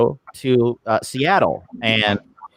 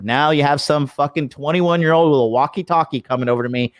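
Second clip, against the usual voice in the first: ordinary talk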